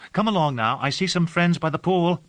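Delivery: gently teasing her companion, lightly voiced; a hint of playful Delilah; Delilah's voice. A narrator's reading. Delilah coaxing him along.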